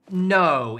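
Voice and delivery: ordinary talk, nothing marked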